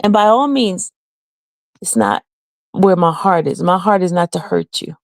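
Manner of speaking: normal talking voice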